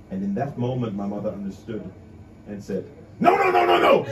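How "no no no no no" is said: high, loud no no no; emphatic refusal. Retold or imitated. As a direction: refuse it sharply and fast. Mother refusing chocolate loudly.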